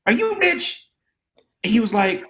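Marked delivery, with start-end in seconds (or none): 0.1-0.9 put-on much higher, innocent kid asking. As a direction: ask it like a wide-eyed seventh grader. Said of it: "I was like".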